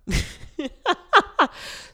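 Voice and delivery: giggling after the song; high laugh. Real, not acted.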